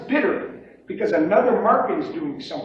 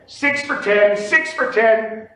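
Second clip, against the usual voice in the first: loud, raised pit call; yelled practice bid; 5 semitones higher, 6 dB louder, much slower than usual. Shown as a demonstration. Practicing a bid alone at home, yelled. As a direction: yell the bid, higher and louder. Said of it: "and you yell it".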